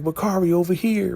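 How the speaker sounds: high, sing-song mock call across the boat. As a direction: call him over in a lightly put-on high voice. [name] calls out to Bakari.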